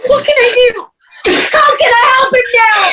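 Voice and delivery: high, fast sobbing shout; desperate cry. Acted. Sister despairing as her brother raves, sobbing shout. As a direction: cry out helplessly.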